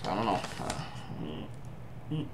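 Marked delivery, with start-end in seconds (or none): none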